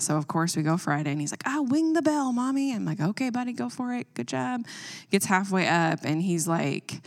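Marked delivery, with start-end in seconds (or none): 1.5-2.7 put-on high little kid voice, lisping wing; a small boy calling to mom from the wall; toddler voice. A two-year-old shouting to his mom from the top, high and lisping. 3.0-4.7 encouraging mom. Retold or imitated. Mom encouraging son in story.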